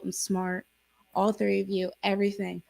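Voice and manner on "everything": ordinary talk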